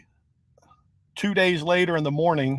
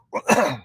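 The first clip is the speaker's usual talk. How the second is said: coughing after swallowing a bug; throat clearing; 4 dB louder than usual. Real, not acted. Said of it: "I just swallowed a bug".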